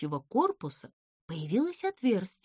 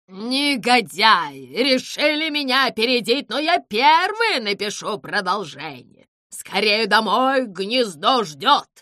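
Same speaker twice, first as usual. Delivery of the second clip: indignant owl; 9 dB louder than usual. Acted. The owl fumes at rivals, loud and indignant. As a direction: rage at the scoundrels.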